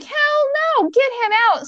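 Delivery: very high-pitched, emphatic refusal; retold parental outrage. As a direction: imitate parents flatly refusing a guest.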